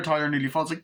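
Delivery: usual speaking voice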